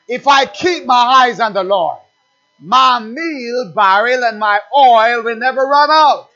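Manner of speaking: loud call-and-response preaching; fiery preacher calling out; preaching at full force. Acted. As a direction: build the rhythm, shout the answer.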